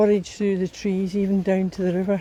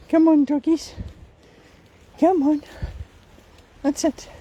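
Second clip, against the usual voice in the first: calling the dogs; 8 semitones higher than usual. Real, not acted. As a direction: urge the dogs on cheerily.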